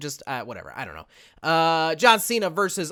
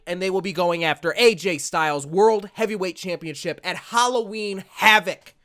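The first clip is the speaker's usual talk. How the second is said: drawn-out, louder announcer build-up; main event hype; 3 semitones higher, 4 dB louder than usual. Acted. Hyping the fatal four-way for viewers, announcer voice. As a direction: make the four-way sound huge.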